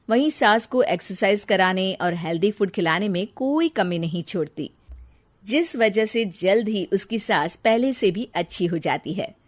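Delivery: ordinary delivery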